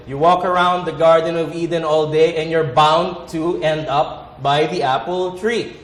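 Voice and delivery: ordinary talk, nothing marked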